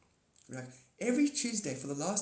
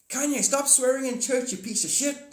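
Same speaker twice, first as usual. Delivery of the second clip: mock scolding; 11 semitones higher, 6 dB louder than usual. Scolding [name] mock sermon.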